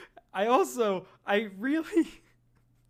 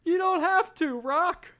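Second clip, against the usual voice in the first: pleading manager; 12 semitones higher than usual. Acted.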